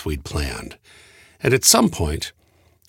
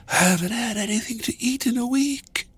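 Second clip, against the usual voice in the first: high, frail, starving voice; weak woman; 12 semitones higher, 3 dB quieter than usual. A narrator's reading. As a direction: plead feebly in a high voice. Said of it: "the weak woman gasped".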